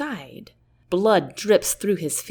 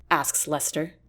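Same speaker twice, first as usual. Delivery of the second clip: plain reading voice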